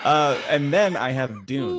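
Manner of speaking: slow, loud, laughing through the words. Real, not acted.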